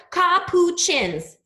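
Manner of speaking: slow, stretched capuchins, each syllable drawn out; teacher stretching out "capuchins" for the class. Shown as a demonstration. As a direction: sound out "capuchins" slowly. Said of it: "It's how many syllables, guys?".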